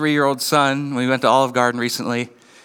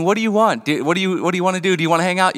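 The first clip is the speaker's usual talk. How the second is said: exasperated asking; 4 semitones higher, faster than usual. Retold or imitated. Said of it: "you find yourself asking".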